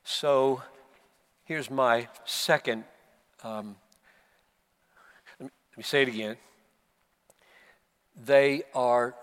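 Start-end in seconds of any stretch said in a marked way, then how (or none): none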